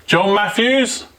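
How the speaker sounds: high drawn-out call upstairs; calling up to window. Real, not acted. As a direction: call the name up, slowly. Calling spirit by name.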